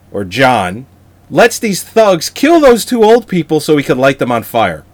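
ordinary talk

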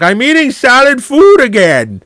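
put-on high, loud, slow old man voice; hammed-up stuffy old boss; mocking old boss. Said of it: "He's like".

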